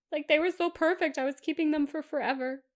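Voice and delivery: high-pitched wail over a loss; plaintive lament. Real, not acted. Laments her ruined palettes.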